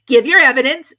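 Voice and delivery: high, loud summons; high, loud king; king calling out. A narrator's reading. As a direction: call across the court. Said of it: "said the king".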